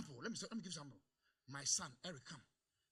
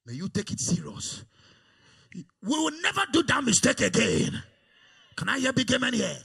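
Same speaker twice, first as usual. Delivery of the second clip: loud, higher rousing preaching; preaching at full force; 5 semitones higher, 18 dB louder than usual. Acted. Pastor rousing the congregation, loud preaching. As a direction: whip the congregation up.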